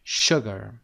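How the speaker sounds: "Sugar" starting with a clear "sh": "shoogar". Shown as a demonstration. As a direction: hit the "sh" in "Sugar".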